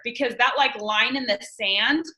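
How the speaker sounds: ordinary talk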